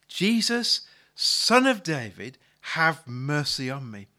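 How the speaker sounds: loud, drawn-out cry for mercy; calling out. Retold or imitated. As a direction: call out to Jesus over a crowd, slowly. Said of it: "he begins to call out".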